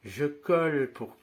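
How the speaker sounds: slow, deliberate demo pace; slow motion. Shown as a demonstration. Magician demonstrating the sleight to students, slowly. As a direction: talk it through slowly while showing the move. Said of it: "je le fais en ralenti".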